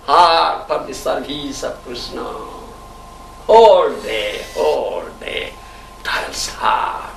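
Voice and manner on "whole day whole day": low, very slow, dwelling on whole; slow emphatic repeat. Acted. Preacher stressing the devotee's long toil, slowly. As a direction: drag out whole day.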